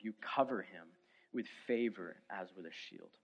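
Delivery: measured reading of a psalm aloud; solemn scripture reading. Acted. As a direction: read the psalm with solemn weight. Scripture reading before sermon.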